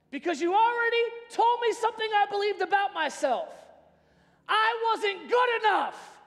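ordinary talk, nothing marked

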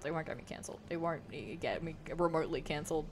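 low, soft self-correction; muttered aside. Real, not acted. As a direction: drop it under your breath.

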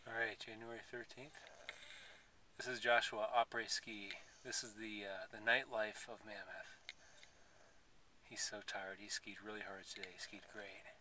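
very soft, low-pitched commentary; hushed narration. Real, not acted. Parent filming tired Joshua after skiing, hushed narration. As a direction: narrate quietly, as if not to disturb.

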